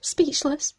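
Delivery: high, breathless awe; awed. Real, not acted. Opening a gift package, genuinely awestruck. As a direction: gasp it out, overwhelmed by the gift.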